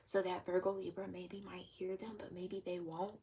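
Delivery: hushed, breathless whisper. Acted. Reader mimics someone saying it really quietly, whispering. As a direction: whisper it so they might not hear. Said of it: "like, whispering it".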